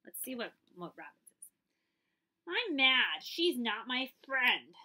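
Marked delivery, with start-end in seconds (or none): 2.5-4.8 loud, slow sulky declaration; a hurt friend loudly declaring it's over; angry. Acted. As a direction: stomp out the words like a cross child. Robin reads Dolly's angry line.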